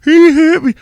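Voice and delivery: high, loud whiny mock woman voice; shrill mock accusation; mocking victim voice. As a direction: mimic her crying foul, way up high. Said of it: "you know what I mean? Like".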